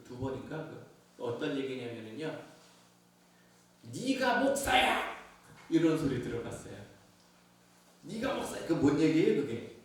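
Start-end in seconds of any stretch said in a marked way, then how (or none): 3.9-5.1 sharp high scornful yell; scornful shout of an accuser; mocking accusation. Accuser yells at pastor. 8.0-8.6 high scornful jab; a taunting accuser. Retold or imitated.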